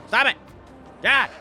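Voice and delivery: fed-up yelling in short bursts; exasperated. Real, not acted. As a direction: snap at a pesky bird. Fed up with noisy bird.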